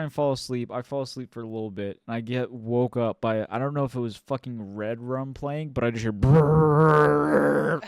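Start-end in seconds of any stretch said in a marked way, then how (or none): 6.1-7.8 loud buzzing bass imitation. Mimicking loud music waking him.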